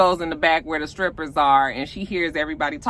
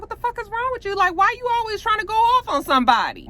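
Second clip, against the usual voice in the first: high-pitched mock telling-off; exasperated scolding; faster than usual. Manager scolding jumpy Murder, exasperated. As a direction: imitate a fed-up manager, voice way up.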